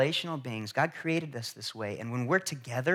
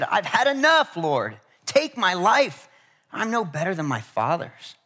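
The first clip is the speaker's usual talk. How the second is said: despairing cry; 5 semitones higher, 6 dB louder, slower than usual. Retold or imitated. Preacher voices Elijah giving up.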